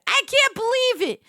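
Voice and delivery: loud, high can't believe it; exasperated outburst. Real, not acted. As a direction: blurt it out in shock. Repeats line louder and higher.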